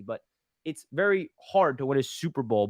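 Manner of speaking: ordinary delivery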